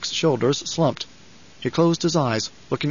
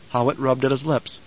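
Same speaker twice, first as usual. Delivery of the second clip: plain reading voice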